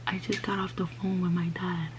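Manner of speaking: repeated, faint whisper; saying it again, head down and teary. Retold or imitated. Repeats it to mom, crying.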